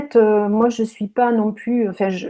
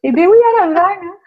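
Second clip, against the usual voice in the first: southern French accent laid on a bit, higher; thick southern French accent; 8 semitones higher, 6 dB louder, faster than usual. Shown as a demonstration. Teasing her host with a laid-on southern French accent. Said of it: "je peux aussi l'accentuer un peu plus".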